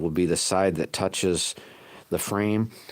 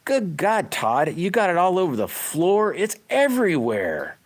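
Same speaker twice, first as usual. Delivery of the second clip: higher, mock-scolding wife; hammed-up exasperated wife; imitating scolding wife; 10 semitones higher than usual. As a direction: playfully imitate the wife's fuss.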